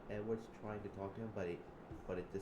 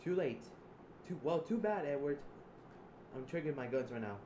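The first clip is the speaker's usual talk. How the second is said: loud, high taunting yell; taunting shout; 6 semitones higher, 5 dB louder than usual. Acted. Taunting Edward before pulling the trigger, shouting high. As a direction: shout it in his face.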